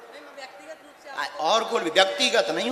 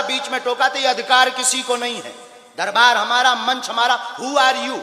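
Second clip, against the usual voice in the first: raised, loud rebuke of a journalist; angry scolding; 4 semitones higher, 5 dB louder than usual. Real, not acted. Guru scolding the reporter who interrupted him, loud and angry. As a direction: tell him off loudly for interrupting.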